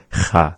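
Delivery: "cha" with a rasp close to an H; raspy CHA. Shown as a demonstration. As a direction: scrape the start of "cha" at the back. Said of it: "sort of a raspy sound".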